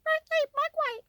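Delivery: mock gremlin voice teasing the viewers. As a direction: chirp it like a tiny gremlin. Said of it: "you guys are like the gremlins".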